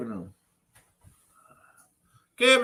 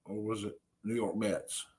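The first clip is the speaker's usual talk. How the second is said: ordinary talk, nothing marked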